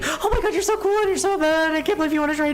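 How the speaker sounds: high-pitched mock fangirl squeal; hammed-up starstruck babble, very high; embarrassing fangirl gushing. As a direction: imitate yourself gushing in a high, excited squeal. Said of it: "I was literally being so embarrassing".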